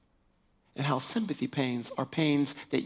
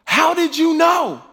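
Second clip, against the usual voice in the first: stunned shout; 13 semitones higher, 12 dB louder, slower than usual. Retold or imitated. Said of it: "she's like".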